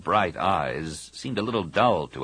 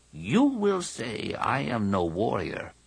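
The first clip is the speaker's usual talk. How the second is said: slow, measured alien elder; unhurried old creature voice; old Jedi Master; 4 semitones higher, slower than usual. Acted. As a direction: speak slowly as an aged teacher conceding a point.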